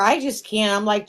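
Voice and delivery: ordinary talk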